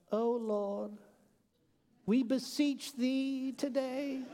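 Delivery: slow, high, solemn churchy prayer voice; formal prayer voice. Retold or imitated. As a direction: intone it like an old-fashioned pious prayer. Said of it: "I gotta put on my formal voice".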